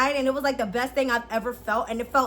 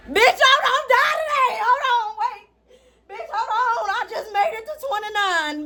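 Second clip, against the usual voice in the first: much higher, louder laughing panic; laugh-yell in mock terror; panicked laughing outburst; 9 semitones higher, 3 dB louder than usual. Real, not acted. Startled mid-video, she shrieks at the scare, laughing.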